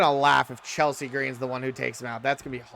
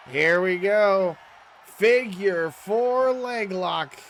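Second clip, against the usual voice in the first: hype call; 6 semitones higher, 5 dB louder, much slower than usual. Acted. Big move hype call.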